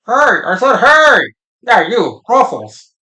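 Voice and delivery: loud, high, brash yell; rude heckler shout. Acted. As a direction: call him out rudely.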